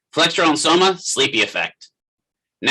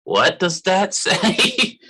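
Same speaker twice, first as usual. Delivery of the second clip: slow, high, puzzled, laughing; drawn-out confused question through a laugh; laughing, squinting confusion; 6 semitones higher, much slower than usual. Retold or imitated. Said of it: "and I'm like".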